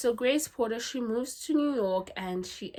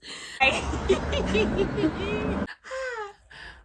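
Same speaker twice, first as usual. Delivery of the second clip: long laugh; 12 semitones higher, 4 dB louder than usual. Real, not acted. Laughing at the funny scene.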